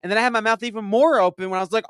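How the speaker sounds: ordinary talk, nothing marked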